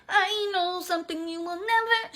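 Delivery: lightly voiced possessed girl; creepy possessed voice. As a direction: taunt like a haunted child. Creepy voice continues.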